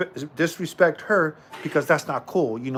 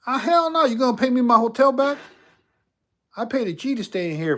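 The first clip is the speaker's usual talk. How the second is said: outraged; 9 semitones higher than usual. Acted. Complaining to the spirit about his hotel money, outraged. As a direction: blow up in disbelief.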